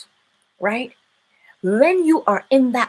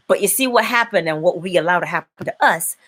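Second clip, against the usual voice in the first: ordinary delivery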